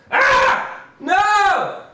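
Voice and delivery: high cartoon shriek; silly scream. Streamer yelping at his own bad take. Screams after botched line.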